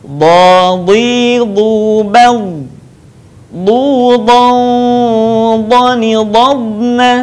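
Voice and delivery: "Dha, dhi, dhu, bal" chanted as a drill; drilled recitation. Shown as a demonstration. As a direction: recite each syllable clearly, like a Quran lesson. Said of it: "Perhatikan sekali lagi".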